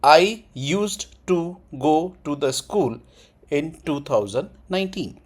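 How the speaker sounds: very slow, every sound clear; syllable by syllable for learners; careful slow pronunciation; pronunciation drill. Shown as a demonstration. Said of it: "प्रोनाउंसिएशन पढ़िए".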